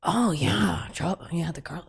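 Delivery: soft mumble fading to a whisper; mumbled backtracking. Retold or imitated. Imitating her date realizing she is serious, mumbled backtracking. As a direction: imitate him backing off under his breath. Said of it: "he is like".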